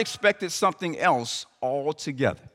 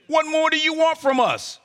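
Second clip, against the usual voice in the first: What more do you want from us raised high, leaning on want; sarcastic demand; 8 semitones higher than usual. Acted. People of Israel sarcastically demanding from God. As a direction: fling it at God like a complaint. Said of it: "Can you sense the sarcastic tone".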